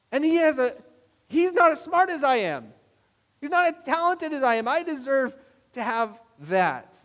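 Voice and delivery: high, loud resentful protest; envious indignation. Acted. Envious neighbor over someone's dream car, indignant.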